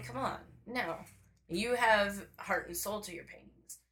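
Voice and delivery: ordinary delivery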